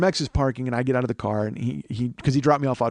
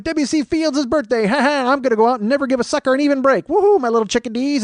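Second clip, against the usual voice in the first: W C Fields impression; 12 semitones higher, 7 dB louder than usual. Fans on Facebook mocked as W C Fields in a high, put-on voice. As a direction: ham up the old movie star's voice. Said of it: "And they're like".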